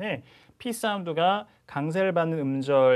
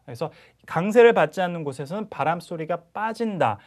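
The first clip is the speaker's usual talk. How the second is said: ordinary talk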